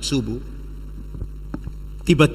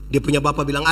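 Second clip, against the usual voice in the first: ordinary delivery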